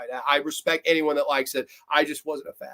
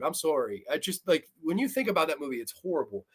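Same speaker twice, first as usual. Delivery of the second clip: usual speaking voice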